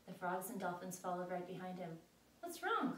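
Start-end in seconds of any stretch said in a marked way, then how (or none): 2.4-2.9 high, loud call. A narrator's reading. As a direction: call up the stairs. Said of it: "called Mister Hopper".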